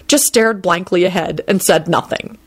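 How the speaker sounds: plain reading voice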